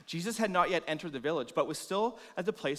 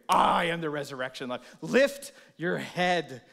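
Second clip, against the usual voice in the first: louder, slow ringing command; slow stately declaration, lifted; commanding proclamation; 7 dB louder than usual. Acted. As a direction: call them upward. Jesus' proclamation to the hearer.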